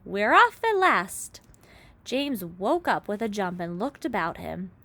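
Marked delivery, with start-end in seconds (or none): 0.1-1.4 high, loud, slow triumphant shout; joyful shout. A narrator's reading. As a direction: cheer it out slowly. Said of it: "someone was shouting".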